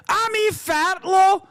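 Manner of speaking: high, slow shouted mock accent; shouted mock Middle Eastern accent. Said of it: "beautiful Middle Eastern accent".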